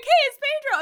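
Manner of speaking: high, loud, hammed-up greeting; mock excitement. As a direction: shout it in mock delight. Mock excited parrot sighting.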